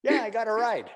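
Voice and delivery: very high, breezy dog greeting; high-pitched, casual show-off dog; cheerful dog voice. Acted. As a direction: play a pleased dog hailing from a passing car. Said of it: "the dog is like".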